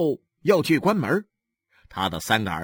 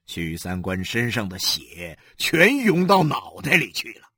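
usual speaking voice

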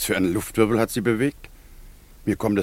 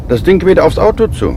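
alarmed shout; 8 semitones higher, 9 dB louder than usual. Acted. Alarmed at spinning thing on road.

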